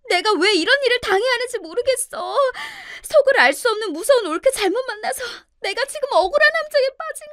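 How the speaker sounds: loud, high-pitched whining wail, easing into anxious appeal; desperate pleading. A narrator's reading. Sister-in-law appealing to her husband beside her, desperate wailing. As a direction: beg to be believed, laying the distress on thick. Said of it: "간절하게 말하기도 했어요".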